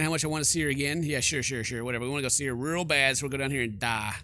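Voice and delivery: usual speaking voice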